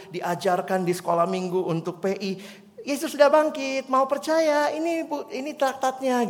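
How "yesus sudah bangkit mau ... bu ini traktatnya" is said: put-on high, eager child offering a tract; timid schoolgirl reciting her line to a stranger; little girl voice. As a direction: play a shy young girl, voice up high.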